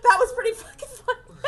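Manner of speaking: high, loud laughing through the words; can't stop laughing; laughing hard. Real, not acted. Cracking up after speed reading.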